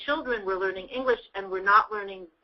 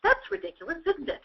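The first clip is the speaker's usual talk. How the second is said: retold exclamation; 8 semitones higher than usual. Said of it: "I'll say something and I'll go".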